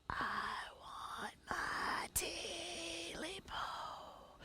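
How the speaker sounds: faint ghostly voice. Acted. Creature's voice carried over the mountain, slow faint whispered moan. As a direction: let it drift in from far away. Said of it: "Oh, so very faint".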